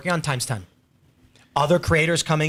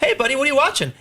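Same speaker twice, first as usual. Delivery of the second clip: high, bright imitation of a pal walking in; mock-cheery voice of a friend dropping by; lightly voiced friend asking what's on; casual buddy greeting; 11 semitones higher, faster than usual. Seamus voiced in retold bit.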